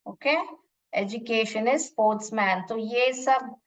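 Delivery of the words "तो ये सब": ordinary talk, nothing marked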